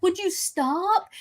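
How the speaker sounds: high, drawn-out exasperated plea; mock-exasperated protest, stretched out. Woman retelling how she begged them to quit, exasperated.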